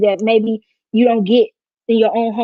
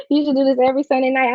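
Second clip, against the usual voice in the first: ordinary talk, nothing marked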